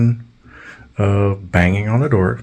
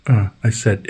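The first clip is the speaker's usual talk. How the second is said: ordinary delivery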